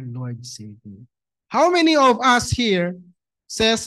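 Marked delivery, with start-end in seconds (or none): 0.0-1.1 slow, much softer, lower cry for help; hushed, slow imitated prayer; quiet plea. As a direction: drop low and soft, a drowning man's plea. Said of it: "Sabi niya".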